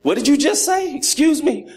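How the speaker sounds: mean daddy. Retold or imitated. Father scolding his hungry son at bedtime, mock stern and high. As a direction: play the stern father catching his son's cheek. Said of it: "I put on my mean daddy face".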